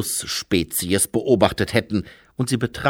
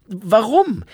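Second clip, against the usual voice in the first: surprised question; 11 semitones higher, 6 dB louder than usual. Acted. Lobsang's sharp question.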